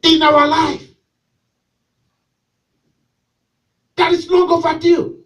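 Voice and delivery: loud, driving preacher's call; fiery preaching. Real, not acted. Pastor preaching to his church, loud and driving. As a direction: preach it out to the congregation.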